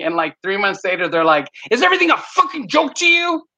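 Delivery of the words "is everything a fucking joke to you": high-pitched, fed-up mock outburst; imitated exasperation. Boyfriend sick of the jokes, imitated exasperation. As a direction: demand it in a raised, exasperated voice.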